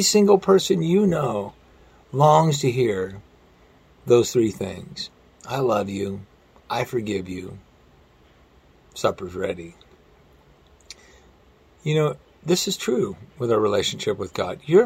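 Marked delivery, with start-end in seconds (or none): 5.5-9.7 soft tender voicing. Preacher voicing God's longed-for words.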